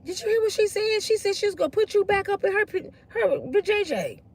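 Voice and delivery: high, soft teasing schoolkid voice; imitating gossiping schoolkids. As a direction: put on a hushed, high-pitched teen gossip. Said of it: "they in school and they be like".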